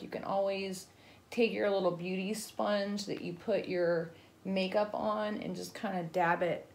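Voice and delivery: usual speaking voice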